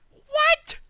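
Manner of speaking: shrieked very high and loud; a single piercing yelp of shock; shocked what. Real, not acted. Streamer startled by something in game, piercing shriek.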